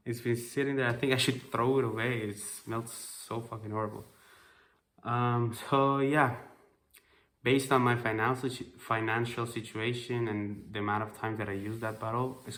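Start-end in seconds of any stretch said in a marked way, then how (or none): none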